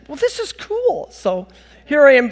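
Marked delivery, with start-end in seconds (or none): 0.1-1.1 high, slow put-on voice; playing the pleased student; mock impressed. Student pleased with art school's advice, high slow voice.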